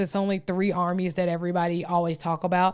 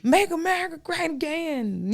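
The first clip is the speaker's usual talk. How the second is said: mock yell; 8 semitones higher than usual. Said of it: "And they was yelling".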